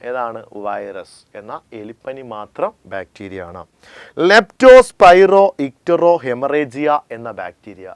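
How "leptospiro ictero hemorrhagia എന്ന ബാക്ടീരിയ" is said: very slow, louder term dictation. Shown as a demonstration. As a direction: drag out every syllable for note-taking. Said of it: "teacher dictates a term". (വളരെ പതുക്കെ ഉറക്കെ പറഞ്ഞുകൊടുക്കുന്നു)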